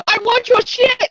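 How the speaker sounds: high, slow mock villain shout; squeaky hammed-up goblin yell; Hobgoblin yell. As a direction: ham up a cartoon goblin demanding. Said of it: "He goes for the window and yells".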